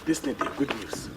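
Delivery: very low, hushed confiding voice; confidential murmur. Acted. Man sharing good news about his wife, confidential murmur. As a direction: murmur it low, barely audible.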